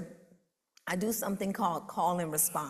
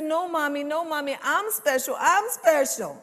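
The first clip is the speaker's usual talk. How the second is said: put-on much higher and louder, whiny child protest; insisting little girl; 10 semitones higher, 10 dB louder than usual. Little girl protesting to her mommy, high, loud, whiny. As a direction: insist like a dramatic seven-year-old.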